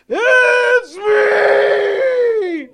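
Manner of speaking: very high, loud shout; excited outburst. Retold or imitated. Man in the congregation claims the prophetic message, excited high shout. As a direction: squeal it out at full volume. Acting out his outburst on hearing George.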